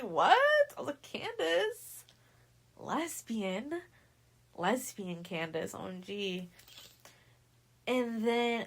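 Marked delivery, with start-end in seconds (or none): 0.0-3.8 exaggerated rising disbelief; incredulous questioning. Storyteller recalling the surprise about Candace, incredulous. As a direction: send each question sharply upward. Retelling own stunned reaction.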